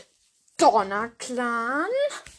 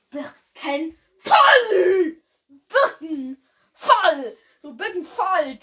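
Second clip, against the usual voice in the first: drawn-out loud shout with long gaps; slow, high, stretched-out cry; dramatic name call; 4 semitones higher, 4 dB louder, much slower than usual. Pretend. Vlogger hailing the cat's name, slow mock-heroic shout.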